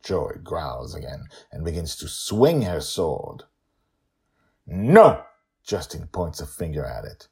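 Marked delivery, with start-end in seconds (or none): 4.7-5.2 loud commanding shout; sharp no. Acted. Father halting his daughter's swing, sharp loud shout. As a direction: stop her cold with one word.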